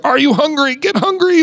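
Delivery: high-pitched mock preacher urging; hammed-up, insistent church pressure; pushy charismatic preacher. Mocking pressure put on the church, high and pushy. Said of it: "especially in the charismatic church".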